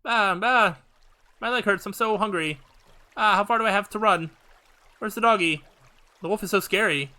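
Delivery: put-on a tired, hungry child lost and scared; lost little girl. Lost girl running from the wolf, worn out and scared.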